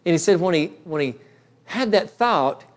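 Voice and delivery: normal talking voice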